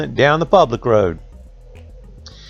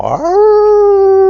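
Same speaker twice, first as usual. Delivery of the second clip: long high loud dog howl; hound howl; 6 dB louder than usual. Retold or imitated. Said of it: "Like hound dogs do, you know".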